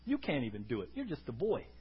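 low, soft dismissal; hushed, deep-pitched belittling; King Saul. Acted. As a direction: quietly put the boy down. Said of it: "King Saul says".

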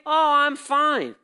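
slow, high, loud mock-cheery "Oh, I'm fine"; church answer. Pastor mocks the congregation's fake-cheerful answer. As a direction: drawl out the fake-happy church reply. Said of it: "'Cause I'm used to the church answer".

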